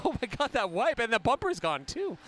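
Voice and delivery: high laughing exclamation. Real, not acted. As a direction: laugh through the words. Laughing at the wipe.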